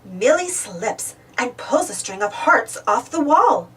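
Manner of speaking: ordinary delivery, nothing marked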